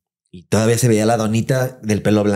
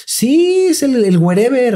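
very high, slow falsetto gushing; falsetto lady; 13 semitones higher, 4 dB louder, slower than usual. Retold or imitated. Scene: housekeeper gushes about her boss, in falsetto. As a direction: squeal it like a starstruck lady.

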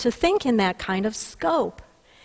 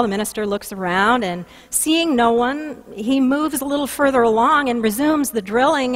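ordinary talk, nothing marked

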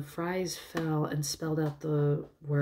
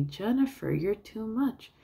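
lightly imitated gushing, higher pitch; Whitey; 6 semitones higher than usual. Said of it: "then Whitey goes".

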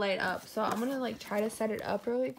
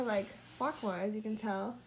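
usual speaking voice

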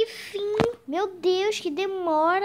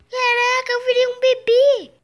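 excited shout; 5 semitones higher, 6 dB louder than usual. Acted. Sees himself in the mirror.

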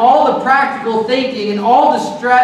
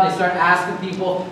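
ordinary talk, nothing marked

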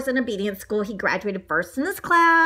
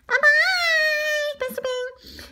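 high-voiced farewell; much slower than usual. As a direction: call a squeaky goodbye that fades away. Donut calls goodbye while leaving.

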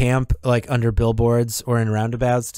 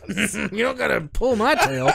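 laughing; 10 semitones higher than usual. Real, not acted. Laughing after being cut off.

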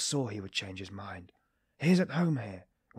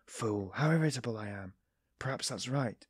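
plain reading voice

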